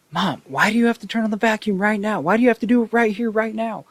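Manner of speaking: high-pitched, loud complaint to Mom; nerd yelling at mom. Retold or imitated. Gamer interrupted by mom's vacuum, exasperated high-pitched yell. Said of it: "some nerd that lives with his mom".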